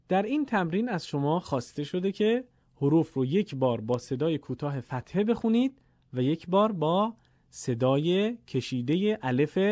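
ordinary delivery

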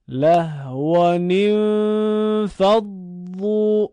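slow, loud Quranic recitation of له و نین فض ظو, fully linked; joined recitation. Shown as a demonstration. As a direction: chant له و نین فض ظو in one flowing line. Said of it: "بعد به صورت پیوسته بخونید". (قرائت پیوسته و بلند)

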